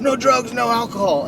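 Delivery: high, loud mock-proud announcement; retold crackhead friend. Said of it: "he's just a fucking crackhead".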